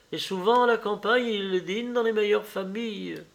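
mother's voice. Acted. (la mère qui sermonne son fils, voix un peu plus aiguë)

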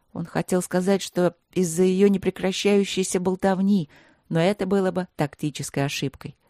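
usual speaking voice

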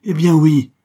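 sudden loud, high outburst; explosive cry. A narrator's reading. Banker bursting out at the countess, explosive cry. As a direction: burst out with a defiant shout. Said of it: "éclatant tout à coup, le misérable s'écria".

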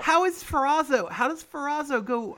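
loud, high, baffled question; baffled outburst. Real, not acted. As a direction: burst out in disbelief. Baffled by fight length.

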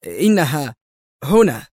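tense, halting report; strained announcement. A narrator's reading. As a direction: get it out nervously, rising in force. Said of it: "وقال في توتر".

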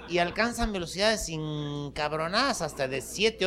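ordinary talk

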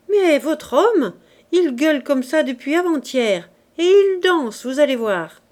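loud guard. Acted. Guard describing raving husband.

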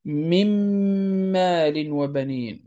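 "من مال وبنين" slowly, the n of "من" merged into a held m; إدغام demo. Shown as a demonstration. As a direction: recite "من مال وبنين" with a long doubled m. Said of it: "يصبحان حرفا واحدا مشددا". ("من مال وبنين" بإدغام النون في الميم بغنة)

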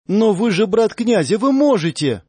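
put-on desperate pleading girl. A narrator's reading. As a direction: throw yourself on his mercy, near hysteria. Amalia insists desperately. (девушка в отчаянии умоляет, высоким голосом на грани слёз)

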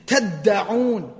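تَدَّعُونَ with a strong, lengthened middle d; emphatic doubled consonant. Shown as a demonstration. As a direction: hold the doubled d in تَدَّعُونَ with force. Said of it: "is with more emphasis".

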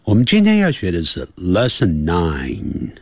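usual speaking voice

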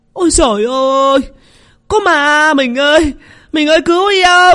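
wife screams for her husband, seeing a ghost; terrified shriek. Acted. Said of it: "tay chân run lẩy bẩy mà rú lên". (rú lên kinh hãi, giọng cao vút)

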